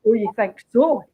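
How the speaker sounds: sneering stress on so; nasty sarcasm. Retold or imitated. The detective's real, nasty sarcastic line. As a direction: lean on "so" with a nasty, sarcastic bite. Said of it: "whereas what she's saying is".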